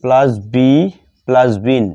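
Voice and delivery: ordinary talk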